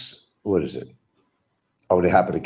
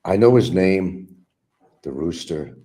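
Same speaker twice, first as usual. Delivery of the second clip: ordinary delivery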